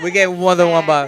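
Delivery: loud, emphatic repeat. Real, not acted. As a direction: hammer the point home.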